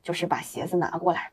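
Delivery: ordinary talk, nothing marked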